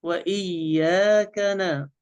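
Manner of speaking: وإياك نا chanted slow, ka and na thin; light kaf and nun. Shown as a demonstration. Sheikh models two light letters, slow chant. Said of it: "الحرف الأول مرقق والثاني مرقق".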